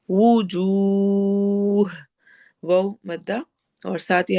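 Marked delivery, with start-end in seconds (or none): 0.1-1.9 long drawn-out वुजूह; Quranic recitation. Shown as a demonstration. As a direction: stretch the वाउ slowly. Teacher recites Quranic verse.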